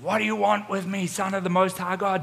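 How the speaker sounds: high, strained demon's plea; possessed man. Retold or imitated. Possessed man at the feet of Jesus, high acted cry. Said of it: "this apparition comes and falls at the feet".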